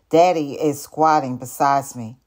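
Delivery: plain reading voice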